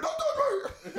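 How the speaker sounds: very high, loud reenacted shriek; hammed-up panic, laughing through it; mock panicked scream. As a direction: imitate a terrified kid screeching. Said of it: "I'm like".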